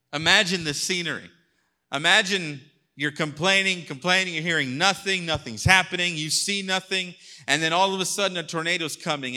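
fast, high, loud build; building excitement. Acted. Preacher painting the storm for the congregation, fast and loud. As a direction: drive the scene to the tornado.

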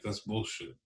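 ordinary talk

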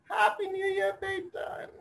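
high mock mom voice; mother greeting her son on the phone, hammed-up high; mother's voice. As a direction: sing it up like a doting Indian mother.

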